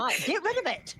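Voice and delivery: shrill-free high burst of outrage; exasperated high yell. Real, not acted. High far exasperated shout.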